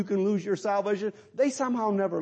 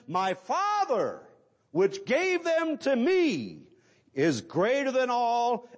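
raised, declamatory preacher's verse reading; emphatic scripture reading; 3 semitones higher than usual. Acted. Preacher proclaiming the Father's keeping power, emphatic scripture reading. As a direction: proclaim the verse with long, weighted words.

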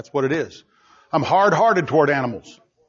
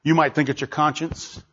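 ordinary talk, nothing marked

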